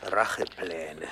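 slow, menacing whisper; sinister whisper. Acted. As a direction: breathe the word like a threat. Boss broods on revenge.

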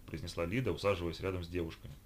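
plain reading voice

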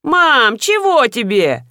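slow, whiny-edged answering call; calling back from upstairs. Acted. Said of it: "спросили со второго этажа".

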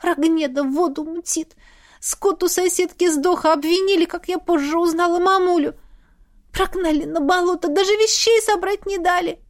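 put-on high, plaintive girl; wronged girl near sobbing; tearful complaint. A narrator's reading. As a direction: pour out the grievance in a high upset voice. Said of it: "Она всхлипнула".

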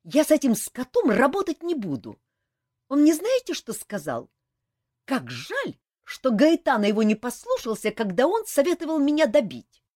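loud, high furious rant; furious outburst. A narrator's reading. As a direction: Saeta rages at her partner, loud and high. Saeta rages at Kantor. (яростно, громко и высоко)

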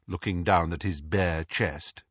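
ordinary delivery

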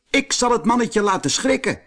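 high, quick cheeky donkey voice; donkey talking. A narrator's reading. Wooden donkey boasting of its plan, high cheeky voice. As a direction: plot a prank gleefully. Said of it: "zei een houten ezeltje".

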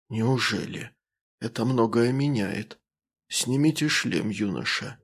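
soft, calm, rustling alien; quiet and unhurried, like rustling leaves. Acted. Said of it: "голос остался спокойно шелестящим".

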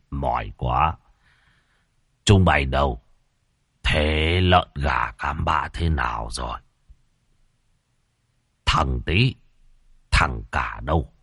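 a hint of a drowsy old landlord, yawning; tired old master. A narrator's reading. Old master calling his servants after waking, tired. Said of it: "Lão Khiêm nói xong thì ngáp ngắn ngáp dài, tỏ vẻ mệt mỏi".

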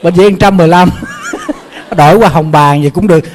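words broken up by real laughter; amused self-correction, laughing. Real, not acted. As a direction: still chuckling, loud. Still laughing at the slip.

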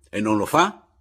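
loud, indignant shouted question. A narrator's reading. Narrator challenges Papiano in the street, shouting. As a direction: fire the question out at full voice. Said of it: "gli gridai forte in mezzo alla strada".

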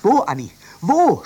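loud captain's cry; shouting. Acted. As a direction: yell the question in excitement. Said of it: "schrie der Kapitän".

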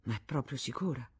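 soft, subdued question; humiliated reply. A narrator's reading. Federico humiliated by the revelation, asking meekly. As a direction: ask it small, stung by the news. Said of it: "umiliato dalla rivelazione".

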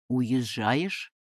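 low-pitched, astonished question. A narrator's reading. Young man learns she is leaving, astonished. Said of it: "изумлённо спросил юноша".

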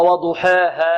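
وضحاها with its long a fronted to "eh"; wrong imala. Shown as a demonstration. Teacher repeats the students' mistake, tilted vowels. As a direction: say وضحاها as "waduheehe". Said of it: "لا نقول وضحاها".

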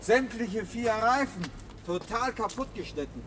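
dismayed discovery. Acted. Discovering slashed tyres.

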